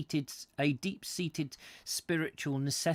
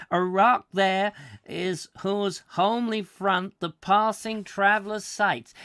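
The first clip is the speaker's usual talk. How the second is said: high, louder American tourist reading the poem; American accent; 7 semitones higher, 6 dB louder than usual. Retold or imitated. American tourist reading Wordsworth aloud to his wife, in an American accent. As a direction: ham up a New York businessman reading verse. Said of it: "I should do this in an American accent".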